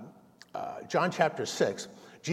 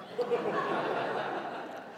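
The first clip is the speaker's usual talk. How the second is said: laugh; 4 dB quieter than usual. Real, not acted. Laugh after punchline.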